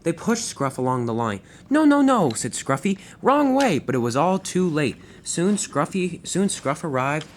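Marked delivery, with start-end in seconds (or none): 1.7-2.3 high, loud panicked cries. Acted. Pushed the wrong way. 3.2-3.8 panicked. Acted. Pushed the wrong way.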